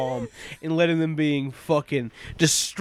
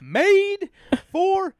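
indignant; 13 semitones higher, slower than usual. Real, not acted. Sarcastic outrage over a children's film.

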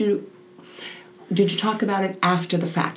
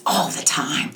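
a small whispered answer; whispered reply; slower than usual. Retold or imitated. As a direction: whisper it like a weary kid. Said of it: "And she says".

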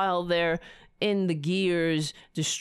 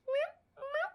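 cat meow imitation; 7 dB quieter than usual. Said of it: "He goes".

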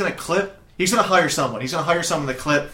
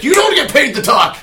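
much higher, loud laughing yell; hammed-up angry boss yelling; mock boss shout; 12 semitones higher, 9 dB louder than usual. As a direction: bark at the hired models. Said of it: "We'd be like".

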